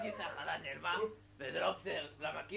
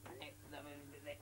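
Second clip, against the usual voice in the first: much softer mumbled reply; quiet sheepish mumble; 2 semitones lower, 12 dB quieter than usual. Acted. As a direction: mumble it under your breath. Mumbling answer to the yelling rabbi.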